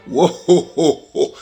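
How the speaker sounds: big jolly high ho-ho laugh, slow and loud; Santa Claus. Pretend. Podcast host opens the show to fans as a booming Santa. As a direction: boom out a jolly Santa greeting. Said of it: "Why am I sounding like Santa Claus?".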